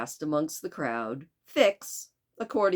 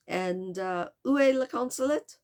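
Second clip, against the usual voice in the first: put-on hesitant French accent, rising higher; Passepartout; 7 semitones higher than usual. Servant asks where the consulate is, poor French accent. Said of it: "tried to do a French accent very poorly".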